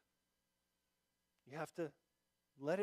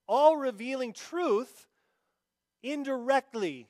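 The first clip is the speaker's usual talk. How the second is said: fervent preaching; 5 semitones higher, 7 dB louder than usual. Acted. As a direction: preach it out to the congregation. Preaching at full force.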